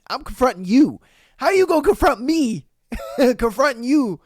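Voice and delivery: imitating the cheater's indignant reply; mock confrontation. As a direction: call out the nerve of it. Acting the confrontation.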